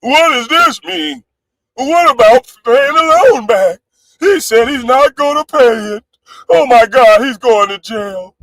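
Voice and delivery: a high, whiny mock of the chat asking about the loan; mocking the askers. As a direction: ham up the nosy, worried chatters. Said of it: "people that wanna come up and ask me".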